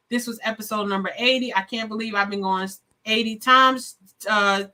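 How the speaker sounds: usual speaking voice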